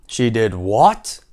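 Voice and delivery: loud, slow disbelief, rising at the end; first, restrained cry of a shocked king; incredulous king. Acted. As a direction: stretch it into a shocked question. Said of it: "cried the king".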